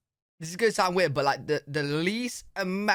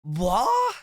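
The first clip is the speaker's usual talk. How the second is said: shocked disbelief; 10 semitones higher, 7 dB louder, slower than usual. Real, not acted. Shocked by the Chicago accent reveal.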